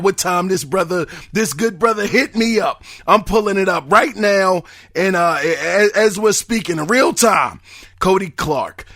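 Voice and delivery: normal talking voice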